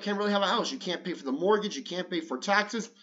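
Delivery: plain reading voice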